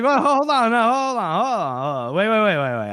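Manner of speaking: high, loud backpedalling that settles down; flustered. Retold or imitated. Voicing the man caught off guard by her offer, flustered. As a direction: imitate a flustered man stalling.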